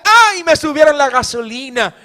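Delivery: high wailing "Ay" sliding into a grumble; whiny mock complaint. Preacher mocks a complaining churchgoer, whiny wail. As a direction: mimic a churchgoer moaning about prices. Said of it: "Llorando, quejándose".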